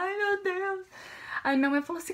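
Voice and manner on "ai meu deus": imitated tearful wail, high pitched; retold crying. Girl breaking down before her mother, retold crying. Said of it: "Eu comecei a chorar".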